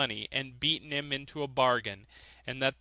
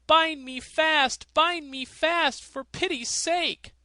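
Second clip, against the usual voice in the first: much higher, louder and slow desperate plea; frantic miser; 13 semitones higher, 6 dB louder, slower than usual. A narrator's reading. Miser pleading to be bound before the fiddle plays, much higher. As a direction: beg them to tie you down in terror. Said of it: "Then the miser said".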